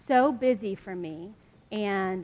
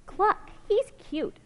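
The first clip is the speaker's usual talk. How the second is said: high, slow clucking hen; lightly voiced hen; 10 semitones higher, slower than usual. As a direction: chirp it like a hen.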